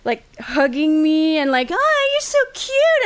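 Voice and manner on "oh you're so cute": high, slow mock cooing; imitated creepy admirer. Freaky date hugging the teller, mock gushing. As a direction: coo it like an overly clingy boy. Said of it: "he was all fucking freaky".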